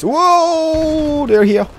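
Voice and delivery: high, loud startled cry; startled shout. Real, not acted. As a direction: yelp as enemies pop up. Enemies suddenly appear.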